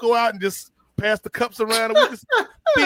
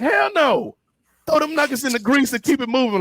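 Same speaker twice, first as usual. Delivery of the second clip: boss snapping; 7 semitones higher than usual. Retold or imitated. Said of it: "She'd be like".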